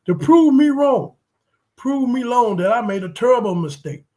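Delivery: high loud challenge to his team; fired-up challenge. Real, not acted. Fan challenging his team, high fired-up plea. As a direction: dare them to prove you wrong.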